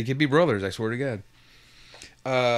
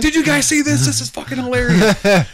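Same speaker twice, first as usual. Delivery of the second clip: excited disbelief; 11 semitones higher, 7 dB louder than usual. Retold or imitated. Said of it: "And I'm like".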